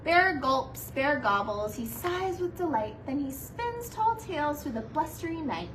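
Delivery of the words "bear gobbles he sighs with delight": plain reading voice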